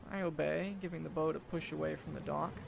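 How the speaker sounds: usual speaking voice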